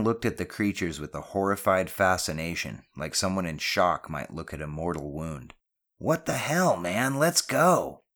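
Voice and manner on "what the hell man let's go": high, louder panicky cry; alarmed cry. A narrator's reading. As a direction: cry out to get everyone fleeing. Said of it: "Janetti cried out".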